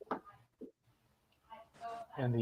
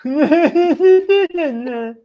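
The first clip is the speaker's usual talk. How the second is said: bursts out in a loud, high laugh; 11 semitones higher, 12 dB louder than usual. Real, not acted. Big laugh on the stream.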